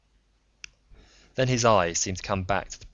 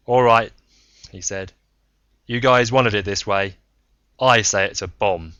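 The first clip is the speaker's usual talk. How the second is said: forced decision; 10 dB louder than usual. Acted. Braun commits to answer.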